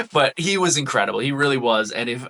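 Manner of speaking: normal talking voice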